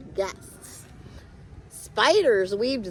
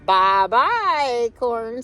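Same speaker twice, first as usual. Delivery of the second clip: called out brightly, louder; leaves calling goodbye; 8 dB louder, slower than usual. Acted. Falling leaves call bye to acorn, louder. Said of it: "said the leaves".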